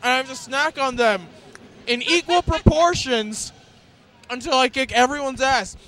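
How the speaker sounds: loud trash talk through food; mouth full. Real, not acted. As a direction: growl it round a full mouth. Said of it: "my mouth is full".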